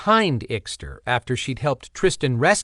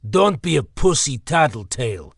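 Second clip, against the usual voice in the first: put-on taunting jab in a Slavic accent. Ixter taunting Bernie before blindsiding him, Slavic accent. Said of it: "Ixter accused".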